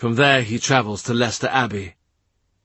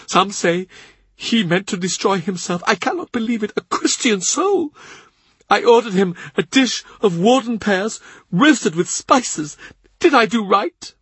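much higher grieving servant's voice; tearful Cavendish; 10 semitones higher than usual. A narrator's reading. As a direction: lift it high like a tearful old retainer. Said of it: "He cries as he talks".

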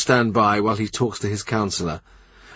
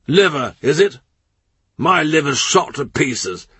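put-on aggrieved old duke; 6 semitones higher than usual. A narrator's reading. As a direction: complain bitterly of aches like a blustering old duke. Norfolk complains of his ailments.

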